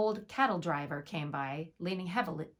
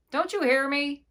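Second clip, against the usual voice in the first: loud, higher shout; shouting his loudest; 7 semitones higher, 7 dB louder than usual. A narrator's reading. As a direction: yell it so she must hear. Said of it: "the innkeeper shouted his loudest".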